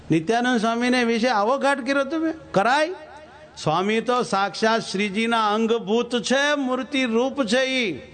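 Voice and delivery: high astonished reproach from the swami; shocked scolding. Acted. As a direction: scold him in disbelief, voice climbing. Swami rebukes Shastri sharply.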